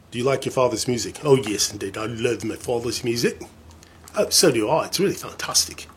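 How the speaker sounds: hammed-up, badly faked British accent. Playing [name]'s kids chatting about father's music, bad British accent. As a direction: put on a silly mock-British accent. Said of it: "the worst British accent you ever hear".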